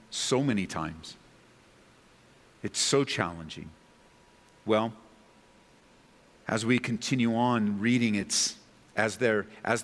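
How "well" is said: ordinary talk, nothing marked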